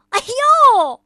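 high loud ouch; Mr. Happy yelps when stepped on; cry of pain. Acted. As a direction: yelp as the foot is stamped. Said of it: "快乐先生叫了起来".

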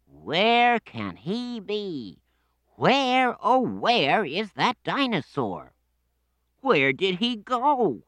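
high, slow singsong mock search; Danny fakes a search, high singsong; pretend searching. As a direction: ham up looking everywhere. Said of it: "Let's make believe we can't find him".

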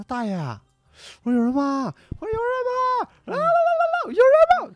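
high, loud shouts up the empty stairwell; calling out loud. Retold or imitated. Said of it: "我上二楼喊了一声". (在空楼里高声大喊大爷)

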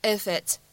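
"if it's" run together as one word; "if it's" said with no break, like "i-fit's"; linked IF IT'S. Shown as a demonstration. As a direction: carry the f of "if" into "it's". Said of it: "咱们可以做一个连读".

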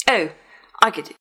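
plain reading voice

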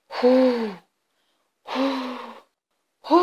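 long hard blowing breaths; wolf puffing with all his might. Acted. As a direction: blow the house down, huff after huff.

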